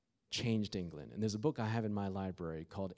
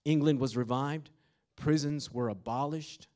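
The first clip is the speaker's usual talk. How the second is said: higher, louder preaching; full-force preaching; 5 semitones higher, 7 dB louder than usual. Acted. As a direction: preach it with fire.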